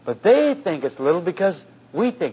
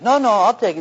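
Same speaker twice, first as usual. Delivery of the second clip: higher retold counter-protest; insistent protest; 5 semitones higher, 3 dB louder than usual. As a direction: insist right back, pitch raised. Retold tug over keeping the paint.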